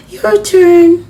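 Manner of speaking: high, loud playful dare; Maddie daring. Acted. Maddie calling down from the wall, high and loud. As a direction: toss the challenge up to your friend. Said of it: "Maddie challenging".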